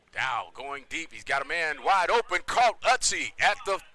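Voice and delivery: building to high, loud play-by-play shout; excited touchdown call. Real, not acted. Announcer calls receiver running for a touchdown, excited shout. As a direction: call the breakaway score at full voice.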